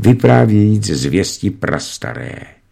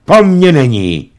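stiff, emphatic, stress on po; curt and pointed; 7 semitones higher, 6 dB louder than usual. A narrator's reading. Husband coldly denying the son takes after him, curt. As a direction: deny it coldly. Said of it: "prohlásil pan Rada odměřeně a výrazně".

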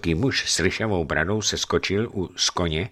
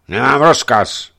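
rough voice; 5 semitones higher, 9 dB louder than usual. Acted. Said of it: "odpověděl drsným hlasem strážce a zamračil se".

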